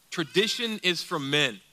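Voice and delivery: ordinary delivery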